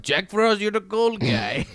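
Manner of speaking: put-on high, slow Russian accent; hammed-up Russian Santa scolding Jack Frost. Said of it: "That's my Russian Santa Claus".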